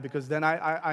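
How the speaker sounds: ordinary talk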